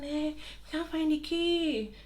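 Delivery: high, slow, drawn-out imitation of neighbors; neighbors' excuse. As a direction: mimic a feeble excuse from behind a door. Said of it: "They're saying".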